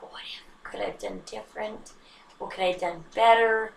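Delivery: whispered worried self-questions; anxious whisper. Retold or imitated. Anxious inner thoughts, whispered.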